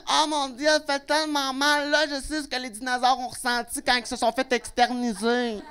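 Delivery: hammed-up drama over a nail; whiny reality star. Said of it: "elle fait". (imite une vedette de téléréalité qui se plaint)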